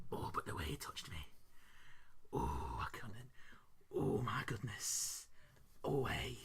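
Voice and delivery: low, breathy mock-swooning whisper; fond reminiscing. Wife remembering his touch, whispered fond reminiscing. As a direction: swoon softly over the memory, hammed up. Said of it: "she was reminiscing. She was looking back fondly".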